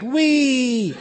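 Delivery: a high, gleeful wee down a slide; excited squeal. Acted. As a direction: let it fly out like a kid on a slide. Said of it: "In his excitement".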